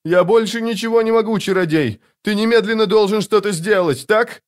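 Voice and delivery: very high, frantic pleading; acted high-pitched desperate demand. A narrator's reading. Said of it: "с отчаянием проговорила женщина".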